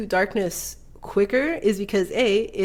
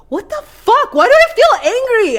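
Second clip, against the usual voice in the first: much higher, loud confused outburst; retold sudden anger; 13 semitones higher, 8 dB louder than usual. Retelling the negativity cloud, angry confused yelling. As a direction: blurt it out, baffled and furious. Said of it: "And I'm just like".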